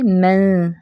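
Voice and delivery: ordinary talk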